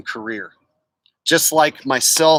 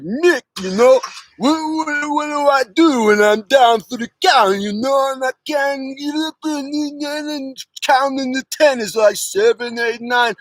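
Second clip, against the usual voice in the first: higher, strained Rocky pleading; 9 semitones higher than usual. Acted. Rocky begging his trainer Mickey, higher strained pleading. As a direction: beg your trainer for an answer, voice up high. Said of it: "Rocky pleading with Mickey".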